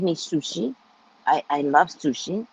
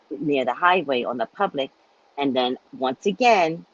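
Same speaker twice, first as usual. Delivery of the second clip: usual speaking voice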